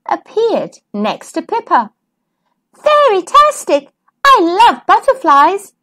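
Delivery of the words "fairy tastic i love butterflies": high, delighted squeal of joy; excited. Acted. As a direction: gush over the butterfly, pitched up. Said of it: "said Pippa".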